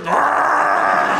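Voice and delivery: wordless high mock wail; long inarticulate groaning yell; hammed-up strangled howl; Helen Keller. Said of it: "Helen Keller's like".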